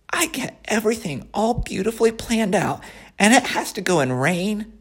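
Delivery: high, whiny piglet voice; a disappointed young pig. Acted. Wilbur complains about the rain.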